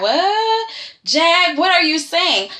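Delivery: high-pitched, drawn-out disbelief; acted-out baffled outcry; incredulous. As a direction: shoot the pitch up as if stunned, then question him. Acting out viewers' disbelief at Jack.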